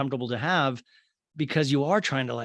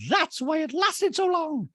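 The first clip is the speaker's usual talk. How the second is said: very high, drawn-out mimicry; mock exasperated housewife; slower than usual. Recapper imitates exasperated castmate, high and slow. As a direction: stretch it out in a high put-on voice. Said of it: "And Drury's like".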